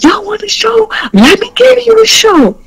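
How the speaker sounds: very high-pitched taunting yell; retold angry threat. Woman threatening the pair recording her, retold angry threat.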